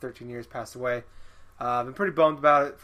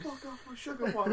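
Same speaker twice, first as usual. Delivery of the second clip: higher, softer squeak of a tiny bird; mock hummingbird; 9 semitones higher, 7 dB quieter than usual. Co-host joking as the bird guarding its sugar water, high little voice. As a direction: play the bird guarding its feeder.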